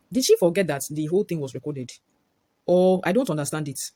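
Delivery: ordinary delivery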